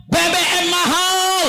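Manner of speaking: drawn-out high cry of complaint; mock crowd cry. Preacher voicing the people crying out about prices. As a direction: wail it slowly like a protesting crowd.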